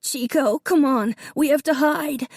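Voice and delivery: put-on a touch of a sobbing little boy. A narrator's reading. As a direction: beg the dog through tears, high up. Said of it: "Davy sobbed".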